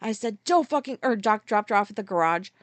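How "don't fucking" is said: sharp, louder, higher burst; pissed off. Retold or imitated. Driver dropping off the passenger, pissed off. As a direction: re-enact an angry order. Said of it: "I was pissed".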